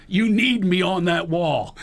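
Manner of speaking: slow, emphatic movie-speech impersonation; drawn-out courtroom-speech delivery; Nicholson. Retold or imitated. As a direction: hit each word slowly and firmly. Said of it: "Kinda like Nicholson".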